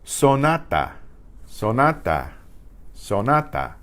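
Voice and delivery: "Sonata" with three even pure vowels; Spanish pure vowels. Shown as a demonstration. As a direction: model "Sonata" for students to repeat. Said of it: "each vowel is its own pure sound".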